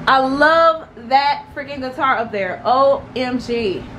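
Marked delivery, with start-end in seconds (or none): none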